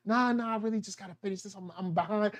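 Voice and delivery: fast, soft brush-off; quick quiet excuse. Retold or imitated. Employee dodging coworkers, quick and soft. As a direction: rush the excuse softly.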